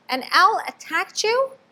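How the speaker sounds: high, alarmed question; a mother fearing the worst; anxious mother bat. A narrator's reading. Mother bat asks her child, high and alarmed.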